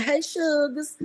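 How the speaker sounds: high country twang, imitating a young son; country-talking little boy. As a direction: mimic a child's country accent. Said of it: "He talks like he's from the country".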